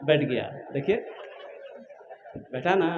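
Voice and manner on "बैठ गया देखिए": ordinary talk, nothing marked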